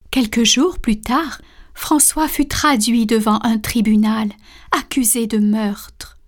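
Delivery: plain reading voice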